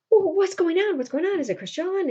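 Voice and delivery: high-pitched mock panic; alarmed questioning. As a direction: imitate a worried woman's urgent questions. Roxanne alarmed by gunfire, acted.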